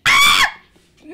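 loud high scream. Real, not acted. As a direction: shriek as it grabs you. Caught by monster.

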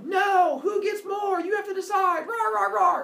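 high, loud mob yelling; angry mob shout. Acted. Groups argue with Esau.

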